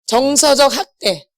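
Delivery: 정서적 학대 said slowly, each word weighted; slow emphatic answer. Shown as a demonstration. Preacher drilling the term into the congregation, slow. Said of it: "무슨 학대?".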